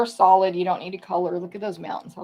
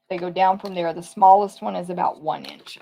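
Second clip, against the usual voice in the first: usual speaking voice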